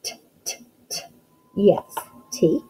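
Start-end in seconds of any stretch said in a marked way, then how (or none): 0.0-1.0 a soft, breathy repeated t sound; isolated letter sound. Shown as a demonstration. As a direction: break the t off short, three times, barely voiced. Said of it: "What's the first sound you hear".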